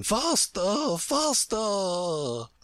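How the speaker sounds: long falling cries urging on; crying out. A narrator's reading. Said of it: "cried the Queen".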